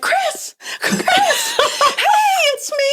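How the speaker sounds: woman calls to her therapist from the car, laughing shout; calling out laughing. Retold or imitated. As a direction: hail him from the car, giggling.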